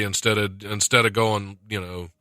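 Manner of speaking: usual speaking voice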